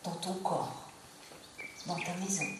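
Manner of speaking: calm yoga nidra guidance with long pauses; unhurried meditation voice, pausing between phrases; guided relaxation. Acted. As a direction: guide them gently into rest, phrase by phrase.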